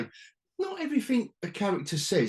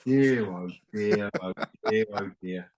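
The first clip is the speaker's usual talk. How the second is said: weary dismay; 6 semitones lower than usual. Real, not acted.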